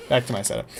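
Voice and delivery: ordinary talk, nothing marked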